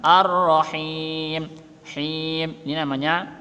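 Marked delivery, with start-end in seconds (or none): 0.0-1.5 Ar-Rahim with the rr pressed hard and held; slow Ar-Rahim, press the tasydid. Shown as a demonstration. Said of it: "Ada ro tasydid penekanan".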